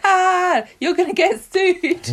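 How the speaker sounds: high-pitched playful taunt; mock gloating. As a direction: crow it at them in pretend triumph. Imagines herself taunting the guard.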